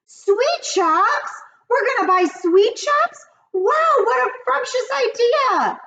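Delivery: very high loud wonder; amazed witches. Acted. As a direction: marvel like a giddy coven.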